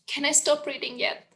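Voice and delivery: usual speaking voice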